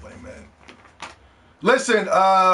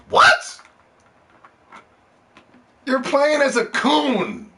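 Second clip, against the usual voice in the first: incredulous; 9 semitones higher, 3 dB louder, slower than usual. Real, not acted. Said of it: "incredulous reaction".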